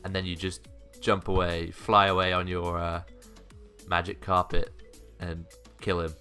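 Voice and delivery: ordinary delivery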